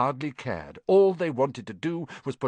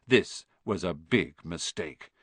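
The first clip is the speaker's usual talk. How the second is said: ordinary delivery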